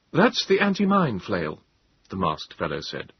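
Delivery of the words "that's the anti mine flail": put-on high-pitched young British officer; clipped officer. A narrator's reading. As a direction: explain it in clipped officer tones. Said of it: "In clipped tones".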